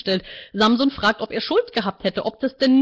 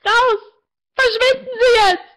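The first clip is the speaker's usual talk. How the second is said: much higher and louder, tearful yell; screaming; 13 semitones higher, 6 dB louder, slower than usual. A narrator's reading. Martina screams at the counselor to leave. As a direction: throw him out at full volume.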